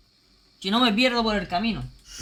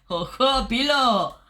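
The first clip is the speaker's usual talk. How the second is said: hyped, louder hail to a newcomer; excited greeting; 3 semitones higher, 4 dB louder, much slower than usual. Real, not acted. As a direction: cheer them as they arrive. Cheering a raid arrival.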